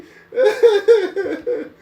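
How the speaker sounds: loud high cackle; helpless shrieking laugh; high cackling laugh. Real, not acted. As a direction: burst out cackling. Big laugh.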